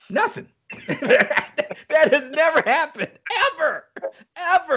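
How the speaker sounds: high, laughing disbelief. Real, not acted. Laughing to his friend about never being told he's good.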